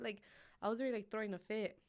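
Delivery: normal talking voice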